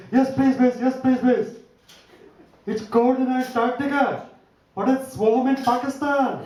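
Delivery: eager student putting on a lightly voiced Pakistani accent. Migrant student answering the headmistress in a mild Pakistani accent. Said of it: "the Pakistani guy jumped to his feet".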